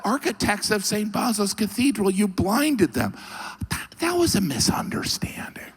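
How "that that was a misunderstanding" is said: whispered, much higher, guilty; hushed, high mock-guilty excuse; sheepish excuse. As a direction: whisper it like a caught kid. Ivan voiced making excuses.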